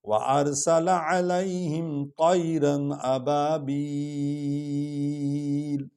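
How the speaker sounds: slow, melodic chanted recitation; Quran recitation. Acted. Teacher recites the full surah for students. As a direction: chant the whole surah slowly, devotionally.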